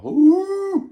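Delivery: very high, loud monkey howl; howler monkey ooh. Retold or imitated. Fellas at night howling in pain, monkey howl. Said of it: "in the nighttime going".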